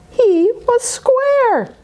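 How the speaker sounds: high, slow, put-on funny voice; comic pumpkin voice; silly high voice. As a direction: stretch out the reveal in a goofy squeak. Punchline in a silly voice.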